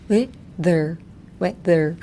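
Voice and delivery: "weather" broken into "we" and "ther"; split syllables. Shown as a demonstration. Teacher drilling weather, syllable by syllable. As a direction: say "weather" in two separate halves, pausing before th.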